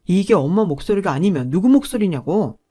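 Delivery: loud, exasperated son; exasperated shout. A narrator's reading. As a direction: yell in disbelief. (기가 막혀 소리치는 아들)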